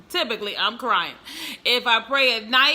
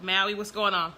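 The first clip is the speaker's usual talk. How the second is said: ordinary delivery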